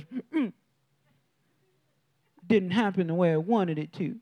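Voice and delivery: lightly mocked child's sulk; sulking kid. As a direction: imitate a small boy sulking. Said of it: "the kid thing".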